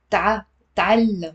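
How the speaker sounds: ordinary talk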